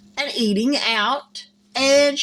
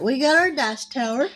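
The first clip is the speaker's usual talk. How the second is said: ordinary talk, nothing marked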